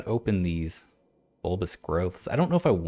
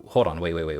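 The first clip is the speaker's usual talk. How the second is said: ordinary delivery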